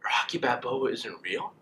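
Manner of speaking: hammed-up, drawn-out tearful question; mock tearful disbelief. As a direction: imitate a heartbroken kid who can't believe it. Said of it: "bawling my eyes out".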